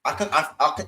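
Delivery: usual speaking voice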